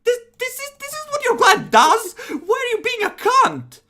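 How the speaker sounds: high-pitched, outraged shouting match; yelling. Acted. Game master voices vampires arguing outside the room, yelling. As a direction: yell it in exasperated disbelief, voice shooting up. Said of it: "a yell from outside the room".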